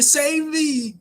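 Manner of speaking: high, loud, hammed-up cry for rescue; desperate cry for help. Usopp stuck in a bear's mouth begging to be saved, high and loud. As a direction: beg like a cowardly kid in trouble. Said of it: "He's like".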